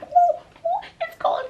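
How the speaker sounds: very high, loud squeal; shocked by cold. Retold or imitated. Stepping out of the airport into cold, high shocked squeal. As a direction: squeal as the cold hits you.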